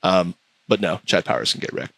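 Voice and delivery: normal talking voice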